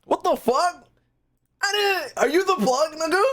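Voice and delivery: high, stunned outburst; baffled and amazed. Real, not acted. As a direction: voice shooting up. Stunned at count.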